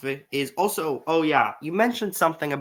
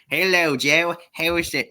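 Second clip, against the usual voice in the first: put-on a first, rough stab at a Southern US accent, pitched up and louder; Southern US accent, rejected first take; 5 semitones higher, 3 dB louder than usual. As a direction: try a quick Southern drawl greeting, a bit overdone. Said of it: "but I had a southern accent".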